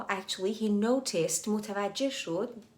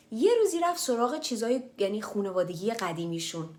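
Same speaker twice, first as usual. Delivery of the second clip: usual speaking voice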